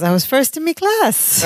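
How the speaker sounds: put-on a touch of Irish accent; a little Irish. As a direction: slip into a hint of Irish brogue. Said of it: "I got a little Irish there".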